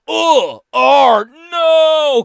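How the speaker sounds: hammed-up waking complaint; mock groggy groan. Mocking the co-host's script, loud high groan. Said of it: "Derek Jeter wakes up and goes".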